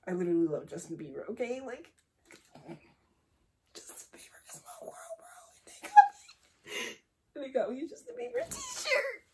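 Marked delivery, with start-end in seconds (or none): 1.6-5.4 fast, breathy fan whisper; overwhelmed whisper. Real, not acted. Overwhelmed fan gets [name] gift. 7.4-9.2 fast, rising to a high squeal; overjoyed fan squeal. Real, not acted.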